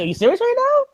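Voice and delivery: high, laughing disbelief; incredulous. Retold or imitated. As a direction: ask it like you can't believe your ears. Incredulous retort to friend Meech.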